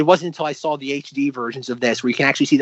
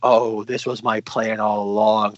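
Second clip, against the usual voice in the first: mock villain; 5 semitones lower, slower than usual. Acts imagined villain.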